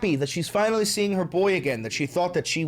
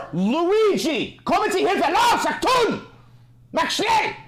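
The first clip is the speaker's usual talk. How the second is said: much higher, louder barked German orders; mock German commander; 12 semitones higher, 4 dB louder than usual. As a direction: ham up a shouting German officer at the dog. Barking orders at dog Luigi.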